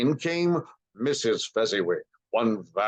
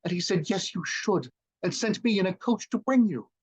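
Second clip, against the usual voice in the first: put-on high, light child's voice; little Fan's voice; 9 semitones higher than usual. A narrator's reading. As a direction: voice the eager little sister. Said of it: "He spoke so gently".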